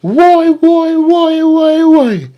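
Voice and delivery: very high, wailing mock cries of why; mock baby wailing. Mocking Nick Fuentes whining like a baby, high mock wail. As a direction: bawl it like a whiny infant, mocking. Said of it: "Let me find Nick a ball like a baby".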